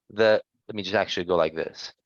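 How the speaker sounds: ordinary talk, nothing marked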